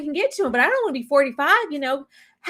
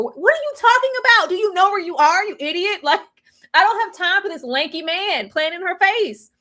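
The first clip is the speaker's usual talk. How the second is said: usual speaking voice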